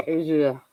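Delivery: Asia said fancily as AY-zhuh; fancy pronunciation. Shown as a demonstration. Streamer repeating a word they like saying, fancy. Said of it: "I just like saying it like that".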